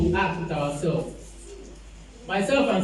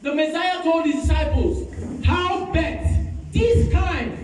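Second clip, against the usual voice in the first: raised, high exhortation to the congregation; fervent preaching; 7 semitones higher than usual. Acted. Pastor exhorting the church to pray and fast, fervent preaching. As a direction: preach it out, pushing the pitch up high.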